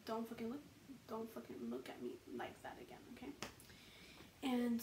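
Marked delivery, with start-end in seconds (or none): 0.1-3.3 menacing warning. Retold or imitated. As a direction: drop the voice down into a threat. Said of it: "I gave him that look that meant".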